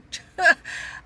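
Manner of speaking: short breathy burst of laughter; real laugh. Real, not acted. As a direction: laugh out at your own boldness. Laughs at leaving son behind.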